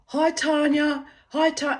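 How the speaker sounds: hammed-up eager phone hello; lampooning an ex's nervous call; mocking the caller.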